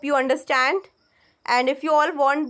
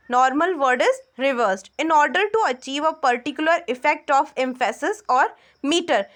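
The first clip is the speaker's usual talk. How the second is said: usual speaking voice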